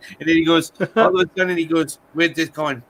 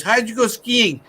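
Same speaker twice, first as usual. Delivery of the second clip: high, lightly voiced puppet; 9 semitones higher than usual. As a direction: squeak it up like a puppet. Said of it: "he goes".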